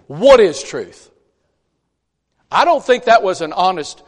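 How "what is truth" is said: aggravated Pilate. Retold or imitated. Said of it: "I think he's getting aggravated".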